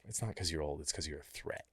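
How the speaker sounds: soft, low, confiding reassurance; hushed reassurance. Retold or imitated. Comforting Donna after she was voted off, a hushed reassurance. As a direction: lean in and reassure her quietly.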